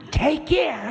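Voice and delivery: high-pitched sharp warning; sharp, high threat from Time. Acted. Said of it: "said Time sharply".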